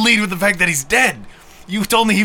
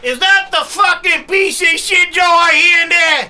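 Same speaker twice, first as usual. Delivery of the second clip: rowdy uncle voice; 9 semitones higher, slower than usual. Acted. New character Uncle Ernie bursts in.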